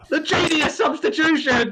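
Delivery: high, slow laughing cheer; laughing shout. Real, not acted. Celebrating goal, laughing.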